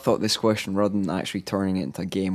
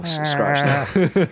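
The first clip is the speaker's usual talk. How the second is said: Brian impression; 5 semitones higher, much slower than usual. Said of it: "for the Brian impression".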